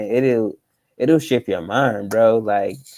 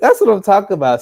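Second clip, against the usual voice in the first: excited; 9 semitones higher, 9 dB louder, faster than usual. Real, not acted. Hyped reaction to the other speaker.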